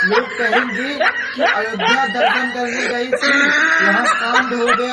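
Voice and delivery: loud, laughing gloat; wife laughs loudly while wishing her mother-in-law dead; laughing gleefully. Acted. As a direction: cackle through the words with glee. Wife gleefully imagines mother-in-law's death.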